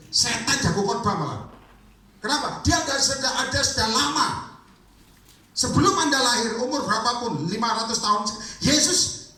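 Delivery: high-pitched revival preaching; fiery preaching. Acted. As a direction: preach hard and fast, rising to a peak. Preaching at full force about Satan.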